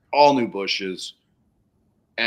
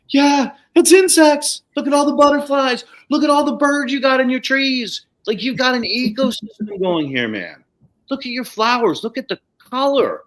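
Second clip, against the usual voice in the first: excited showing off; 13 semitones higher, 5 dB louder than usual. Retold or imitated. Showing the client her lively garden, high excited voice. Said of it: "I'm like".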